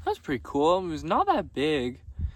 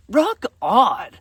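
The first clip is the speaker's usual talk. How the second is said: cheering; 8 semitones higher, 10 dB louder than usual. Retold or imitated. Strangers cheering his outfit.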